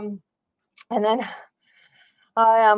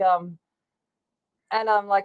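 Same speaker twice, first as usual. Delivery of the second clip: ordinary delivery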